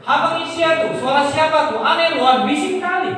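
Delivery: high, loud irritated complaint; angry father. Retold or imitated. Father complaining about the screaming noise, angry. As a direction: imitate an annoyed dad calling out over noise. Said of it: "Iya, dia marah-marah memang".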